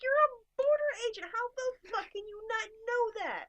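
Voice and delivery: high, loud, exasperated yelling; exasperated retold rant at an official; incredulous outburst. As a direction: shout it in sheer disbelief. Acted outburst at ignorant border agent.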